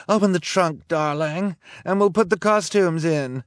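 high, loud bossy call; villainess calling out. A narrator's reading. Villainess gives her boyfriend orders at the car, calling out. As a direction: order darling about from afar.

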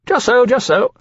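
put-on high, eager, ingratiating young dragon voice; fawning dragon Caesar. Young dragon Caesar flattering the captain, in a high ingratiating voice.

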